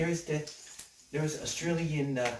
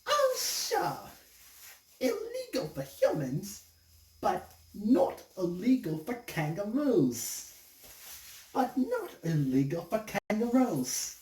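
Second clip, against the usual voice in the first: female kangaroo; 7 semitones higher, slower than usual. Acted. Kangaroo arguing the law with him, high put-on voice. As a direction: retort in a drawn-out high kangaroo voice. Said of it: "And she says".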